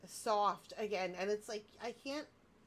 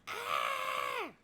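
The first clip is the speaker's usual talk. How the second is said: excited squeal. Retold or imitated. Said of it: "I was like".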